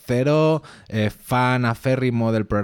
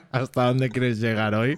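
laughing; 3 dB quieter than usual. Real, not acted. Laughing at the partner's joke.

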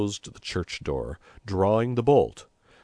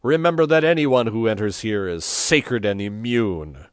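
loud, raised, commanding proclamation; shouted warning; 4 semitones higher, 7 dB louder than usual. A narrator's reading. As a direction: proclaim sanctuary to a mob. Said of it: "he shouted".

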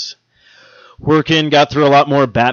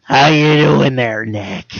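put-on slow, dragged-out raspy old lady voice; Roz impression; much slower than usual. Mocking the boss as Roz from Monsters, Inc. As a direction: drawl it like a chain-smoking clerk. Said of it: "like Roz from Monsters Inc".

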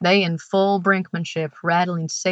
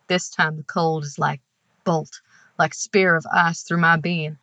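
ordinary delivery, nothing marked

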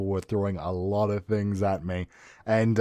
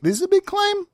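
much higher, incredulous; mock outrage, pitched way up; shocked; 4 dB louder than usual. Real, not acted. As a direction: gasp at how big the claim is. Reacting to shocking complaint.

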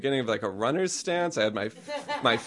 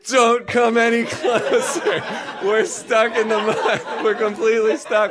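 screaming warning; 11 semitones higher, 5 dB louder than usual. Retold or imitated. Two friends in mud warn dad off, screaming. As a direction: holler it high and long, urgently. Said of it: "start screaming".

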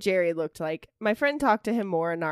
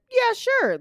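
clueless agreement; 5 dB louder than usual. Retold or imitated. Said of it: "like an idiot".

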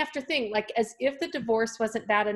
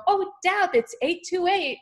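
higher, thrilled mentor pointing out eight two eight; excited mentor; 9 semitones higher than usual. Retold or imitated. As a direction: play the delighted mentor spotting the numbers. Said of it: "and she would go".

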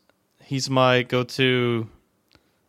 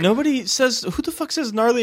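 incredulous; 8 semitones higher than usual. Real, not acted. Mocking the word gnarly.

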